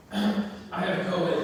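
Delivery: slow, put-on sickly voice after clearing the throat; hammed-up sick caller; fake sick voice. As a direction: fake being ill for the boss.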